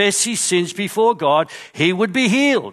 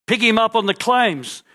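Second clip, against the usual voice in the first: ordinary talk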